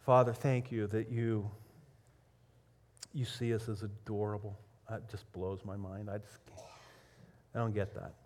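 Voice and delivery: low, soft prayer voice; hushed, heartfelt talk to God. Real, not acted. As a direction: pray intimately, easing down in pitch and volume. Leads the congregation in prayer.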